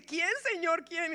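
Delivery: a startled disciple pressing for the name; alarmed disciple. Acted.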